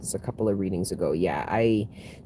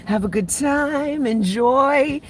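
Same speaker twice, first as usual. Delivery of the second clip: high, slow, airy invitation; lilting high voice urging fun; sad season voice; 13 semitones higher, 3 dB louder, slower than usual. Retold or imitated. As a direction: coax them out like a sweet hostess.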